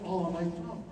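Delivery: ordinary talk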